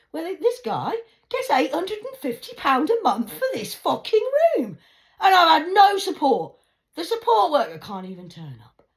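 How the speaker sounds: high, very loud furious rant; real angry shouting; angry outburst. Real, not acted. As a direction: shout the grievance at full force, high-pitched. Angry rant about housing and support.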